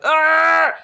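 a high hammed-up pirate-ish growl; mock growl. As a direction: bark out a cartoon roar. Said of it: "And then Janet's like".

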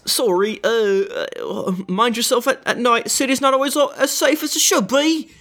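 high, hurried apologetic guard; suddenly meek guard calling to his mate; flustered guard. Acted. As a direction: backpedal fast like a caught-out guard. Guard warns the party.

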